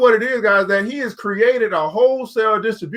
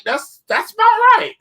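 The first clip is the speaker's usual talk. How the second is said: much higher and slower, a put-on falsetto; mock high voice; 12 semitones higher, 4 dB louder, slower than usual. Vlogger joking to viewers about inflation, mock high voice. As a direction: stretch it out way up high as a joke.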